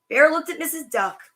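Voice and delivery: ordinary delivery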